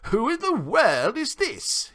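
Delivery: high, loud, drawn-out indignant question; startled, high-pitched demand; surprised exclamation. A narrator's reading. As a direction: exclaim in slow, high astonishment. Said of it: "first exclamation of surprise".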